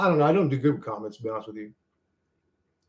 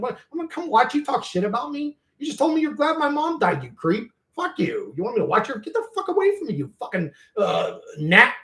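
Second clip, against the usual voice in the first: high indignant rant, thrown right back at him; mock outrage, pitched way up; retold angry rant; 8 semitones higher than usual. Telling off a rival streamer who mocked him, high indignant rant. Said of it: "It's like".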